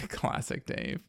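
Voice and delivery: breathy laugh through the words. Real, not acted. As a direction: chuckle it out softly. Echoing co-host, laughing.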